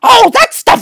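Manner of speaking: plain reading voice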